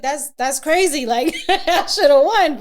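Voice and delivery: loud, high, slow, laughing indignation; incredulous, drawn out with laughs; laughing protest. Real, not acted.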